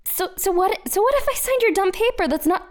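high, loud, fast protest; angry outburst. Acted. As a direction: blurt it out furious.

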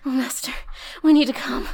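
high, loud desperate cry; climax cry. Acted. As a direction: cry out at the peak.

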